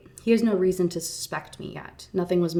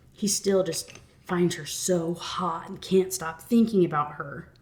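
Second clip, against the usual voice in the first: normal talking voice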